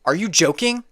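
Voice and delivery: ordinary talk, nothing marked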